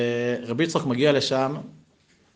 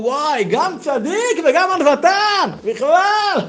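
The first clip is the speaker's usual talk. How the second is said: much higher, slow, amazed voice; awed host; 6 dB louder, slower than usual. Acted. Host amazed at the humble guest, high and slow. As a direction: marvel slowly at his modesty, high up. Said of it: "אומר לו".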